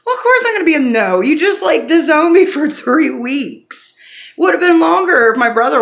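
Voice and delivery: heated laugh at being disowned for weeks; real laughing exasperation. Real, not acted. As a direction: laugh it off while still stung.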